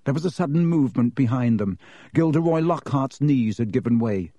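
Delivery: ordinary delivery, nothing marked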